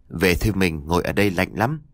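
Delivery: low, gentle plea to come home. A narrator's reading. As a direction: ease her away tenderly. Husband coaxing wife at night.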